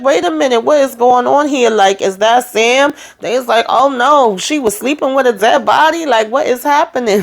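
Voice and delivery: stunned wedding crowd crying out; shocked guests. Retold or imitated. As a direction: sound like the whole room just saw the tape.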